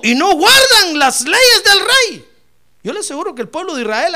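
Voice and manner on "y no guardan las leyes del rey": high, shouted accusation; declaimed accusation. Acted. Adviser denouncing God's people to the king, loud declaiming. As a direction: thunder it slowly, pitched way up.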